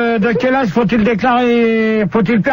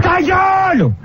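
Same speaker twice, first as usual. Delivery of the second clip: high, exasperated yell; angry shout; 8 semitones higher, slower than usual. Real, not acted. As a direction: explode at an interruption. Host shouts down interrupting caller. (cri de colère aigu)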